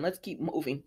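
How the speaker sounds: muttered softly; soft mutter "Let's keep moving" before a laugh; quiet aside. Real, not acted. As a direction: drop it under your breath, moving on. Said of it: "moving on".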